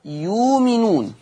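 slow "يؤمنون" said as "يومنون" with a waw; hamza dropped. Shown as a demonstration. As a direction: recite "يؤمنون" replacing the hamza. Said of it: "يقرأ". (إبدال الهمزة واوا في "يؤمنون")

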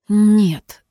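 curt no through the teeth; through gritted teeth. A narrator's reading. Girl answering a taunt, through gritted teeth. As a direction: force it out, restrained anger. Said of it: "процедила Джемма".

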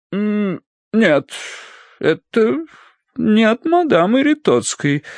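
high, hesitant, drawn out with pauses; puzzled drawl. A narrator's reading. Mistress finds the letter is from someone else, puzzled. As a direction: trail through it in puzzlement. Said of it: "протянула она озадаченно".

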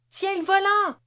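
high, loud yelp of command; yapped order. A narrator's reading. Ruby orders Hans to take the wheel, yelping. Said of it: "jappa Ruby".

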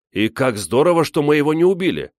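high, loud, quickening delight; rejoice, rushing to the end; joyful boy. A narrator's reading. Гриша glad they spared the bear, excited.

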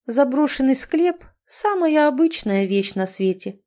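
high start, slow offhand finish; matter-of-course. A narrator's reading. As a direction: make it sound utterly ordinary. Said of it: "произнёс котёнок голосом, в котором читалось".

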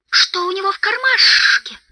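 high, loud creature wail with hissing; Gollum's loud hiss. A narrator's reading. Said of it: "услышал он громкое шипение". (громкое пронзительное шипение Голлума)

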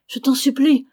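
loud, high desperate plea; pleading cry. A narrator's reading. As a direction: beg him with the voice lifted high and loud. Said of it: "s'écria Charlotte".

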